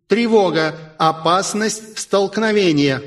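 station computer warning the crew, a cool female alarm. A narrator's reading. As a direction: announce the alarm coolly. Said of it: "прохладный женский голос".

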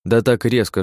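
ordinary delivery, nothing marked

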